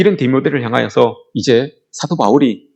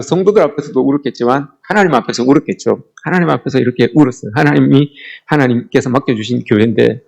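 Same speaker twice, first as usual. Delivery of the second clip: normal talking voice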